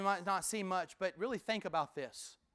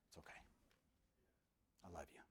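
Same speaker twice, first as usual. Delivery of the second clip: very soft, hushed comfort; tender reassurance; 29 dB quieter, much faster than usual. Acted. God comforting the repentant sinner, soft and loving. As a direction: whisper it like comforting a child.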